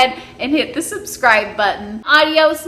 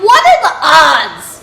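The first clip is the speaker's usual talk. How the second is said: ordinary delivery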